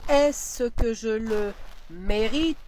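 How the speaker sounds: slow, every word laid out; slow dictation. Shown as a demonstration. Teacher repeating the dictation, very slowly. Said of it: "dictation repeated".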